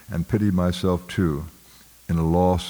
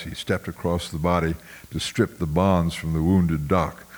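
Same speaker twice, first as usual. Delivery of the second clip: ordinary delivery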